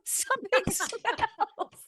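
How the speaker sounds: slow, laughing fix of the punchline; laughing correction. Real, not acted. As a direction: laugh through the corrected word. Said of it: "See, I even did it wrong".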